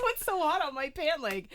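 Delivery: a husband asking, baffled and loud; imitating husband. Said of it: "He tested it".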